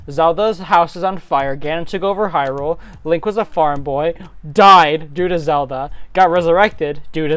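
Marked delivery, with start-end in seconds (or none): none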